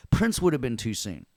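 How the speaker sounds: ordinary talk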